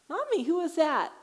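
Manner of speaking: put-on high, loud little-boy question; piping up like a curious kid; child's voice. Son asking mom about the shushing woman, high and childlike. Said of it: "And so Rowan says".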